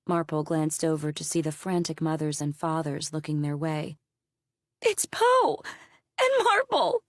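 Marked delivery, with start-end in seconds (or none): 4.8-7.0 high, loud excited cry of recognition; woman shouting. Acted. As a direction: shout their names, pitched way up. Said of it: "one of the women shouted".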